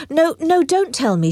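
high, urgent no, no; crying out. Acted. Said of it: "she cried".

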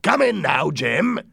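high, loud pirate call; shouted summons. Acted. Silver summoning Jim, shouting. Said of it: "Silver shouted".

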